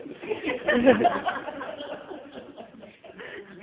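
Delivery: long loud laugh. Real, not acted. Laughing after thanking Cecil.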